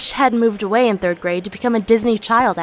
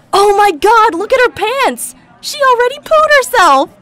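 much higher, much louder shriek of mock horror; screaming; 11 semitones higher, 6 dB louder than usual. [name] shrieking at the class about Sana's pants, loud. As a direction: shriek it so the whole class hears. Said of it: "I screamed".

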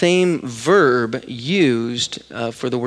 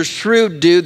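ordinary talk

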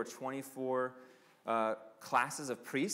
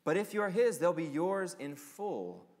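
quoted preaching; 3 semitones higher than usual. Acted. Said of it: "Listen to what he says".

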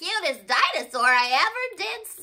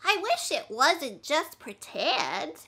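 ordinary talk

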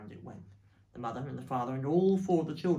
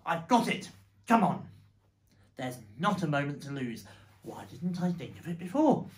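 loud, raised burst of inspiration; urgent, electrified exclamation; excited shout; 2 semitones higher, 8 dB louder than usual. Acted. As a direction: call out a sudden idea. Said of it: "jumped up and shouted".

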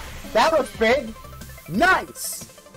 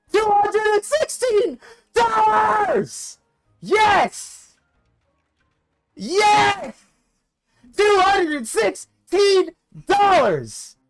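shrieking jackpot winner; 10 semitones higher, much slower than usual. Real, not acted. Huge slot win called out.

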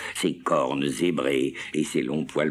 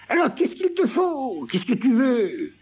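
très aigu, presque fausset, exaspéré; Seguin désemparé; 3 dB louder than usual. Acted. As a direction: lever les bras au ciel. (Seguin désemparé, voix très aiguë)

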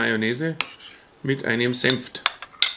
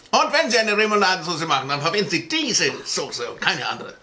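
upbeat TV-ad pitch, a bit higher; infomercial; 3 semitones higher than usual. Pretend. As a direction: sell it like a shopping channel host. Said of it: "Sollen wir einen Werbeblock machen?".

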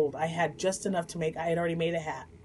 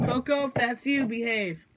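louder, higher call to the dog; 7 semitones higher, 6 dB louder than usual. Real, not acted. Owner calls out to dog playing with door, raised warning. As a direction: warn the dog from across the house.

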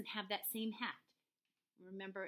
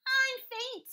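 very high, loud squeal; Roly Pig; 9 dB louder than usual. Acted. Pig makes excuse to stay aboard, high squeal. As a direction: squeal it like a cartoon piglet. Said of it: "squealed Roly Pig".

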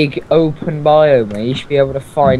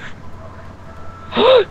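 1 sharp gasp. Real, not acted. Finds Komodo egg.

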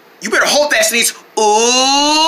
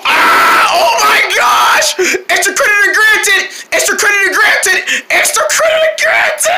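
excited shout; 8 semitones higher than usual. Acted. Excited screaming.

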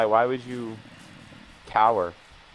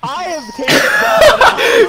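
laughing; 11 dB louder, much slower than usual. Real, not acted. Drawn-out mocking line.

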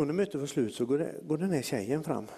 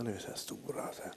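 hushed mock awe; 11 dB quieter than usual. Mimicking hushed holy church talk.

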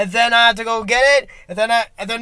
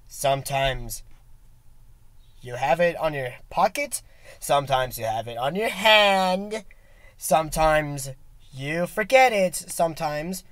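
mock deep musing; 6 semitones lower, 3 dB quieter than usual.

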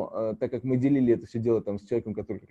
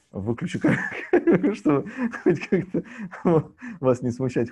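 laughing; 8 semitones higher, 4 dB louder than usual. Real, not acted. Guest excusing the switched-off camera to listeners, laughing. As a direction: talk through a real laugh.

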